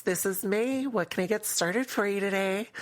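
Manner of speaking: high, bright barista greeting; chirpy customer service voice. Shown as a demonstration. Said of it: "slipped into my customer service voice".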